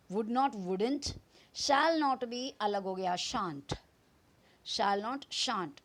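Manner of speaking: ordinary delivery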